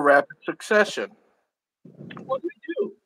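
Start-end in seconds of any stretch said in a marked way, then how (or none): 2.2-3.0 high soft worried question; quiet and anxious. A narrator's reading. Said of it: "Eric worried".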